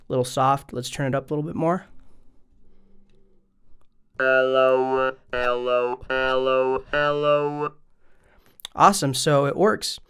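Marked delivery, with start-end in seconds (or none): 4.2-7.7 robotic synth-vowel hello on a held note; talk box effect. Shown as a demonstration. Host testing the talk box setup, robotic talk box voice. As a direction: mouth the word through a buzzing talk box.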